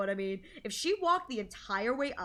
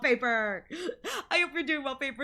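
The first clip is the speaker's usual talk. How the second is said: ordinary delivery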